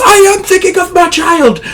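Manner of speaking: very high, anguished mock scream; hammed-up hysterical soldier, voice strained high; screaming argument. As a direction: scream back in desperate defiance. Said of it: "screaming at each other at this point".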